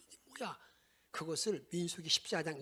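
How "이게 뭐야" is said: breathy whispered lament; hushed stage whisper of dismay. Retold or imitated. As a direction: drop to a whisper, disbelieving. Whispered end of people's complaint.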